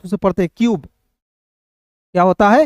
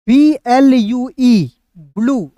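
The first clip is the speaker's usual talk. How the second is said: slow dictation of B L U E; slow spelling out; 2 semitones higher, much slower than usual. Shown as a demonstration. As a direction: spell each letter out slowly, then blue. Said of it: "यहाँ पे समझिएगा". (धीरे-धीरे अक्षर बोलना)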